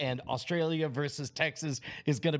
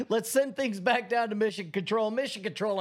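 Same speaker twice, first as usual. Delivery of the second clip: announcer voice; 5 semitones higher than usual. Acted. Sending the show to Mission Control in an anchor voice. Broadcast handoff parody.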